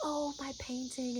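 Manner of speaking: very soft, high, slow cooing; tender wonder. Retold or imitated. Imitating a friend shown her own painting, soft slow wonder. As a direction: imitate someone softly treasuring a keepsake. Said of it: "she was like".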